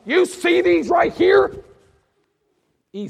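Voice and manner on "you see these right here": slow, very high, loud challenge; raging sergeant. Retold or imitated. Sergeant showing his E six stripes, loud and enraged. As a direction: shove the stripes in his face. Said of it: "he's like".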